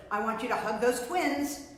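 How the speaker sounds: usual speaking voice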